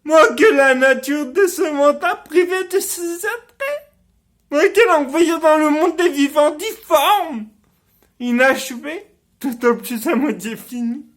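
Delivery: loud, high-pitched theatrical tirade; impassioned stage rant, high and forceful, easing at the very end; bitter outburst. Acted. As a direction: cry out in wounded self-loathing. Acted bitter outburst about own deformity.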